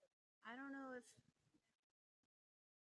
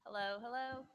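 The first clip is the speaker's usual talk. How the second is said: ordinary talk, nothing marked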